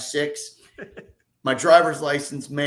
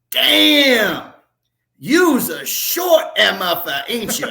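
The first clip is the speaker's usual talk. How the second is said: loud, high, drawn-out Southern exclamation; big mock-Southern outburst; Lee Smith; 8 semitones higher, 9 dB louder, much slower than usual. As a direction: holler it in a Southern accent, astonished at how short they are. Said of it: "in his Southern accent".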